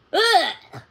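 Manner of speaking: loud gagging ugh; disgusted gag. A narrator's reading. Puppy gagging on a doggy treat, loud disgusted ugh. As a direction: gag on the taste.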